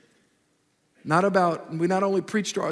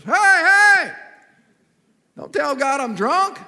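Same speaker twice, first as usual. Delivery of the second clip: sudden loud, much higher shout, then slower pleading; drunk man's outburst; 8 semitones higher, 7 dB louder, slower than usual. Retold or imitated. Drunk man interrupts the pastor's prayer with a loud, high shout. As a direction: yell Hey hey, then beg slowly. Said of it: "He goes".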